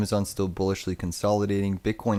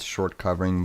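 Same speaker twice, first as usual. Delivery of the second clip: ordinary talk, nothing marked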